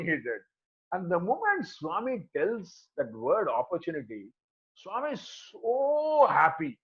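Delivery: ordinary delivery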